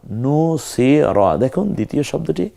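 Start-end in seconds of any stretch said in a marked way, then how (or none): 0.1-1.4 নু সি র, very slow, with a short র at the end; slow Arabic syllables. Shown as a demonstration. Teacher drills নু সি র slowly for the class. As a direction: spell out নু সি র, stopping short on র. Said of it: "নু সি রা না হয় নু সি র".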